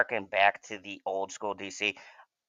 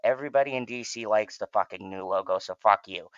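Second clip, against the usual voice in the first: normal talking voice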